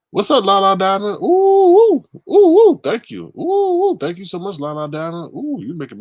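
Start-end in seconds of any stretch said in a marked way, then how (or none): 1.2-2.8 high, loud excited oohs; a run of loud high ooh squeals. Real, not acted. Reacting to a donation. 3.4-4.0 high, loud excited oohs; a run of loud high ooh squeals. Real, not acted. Reacting to a donation.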